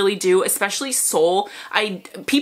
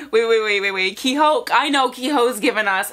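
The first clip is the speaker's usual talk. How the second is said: normal talking voice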